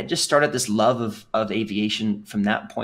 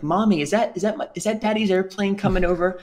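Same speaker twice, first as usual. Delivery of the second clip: imitating his small child self, pitched up high; high little-kid voice; 9 semitones higher than usual. A little boy asking his mom about planes, high and eager. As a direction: ask like an excited little boy spotting planes.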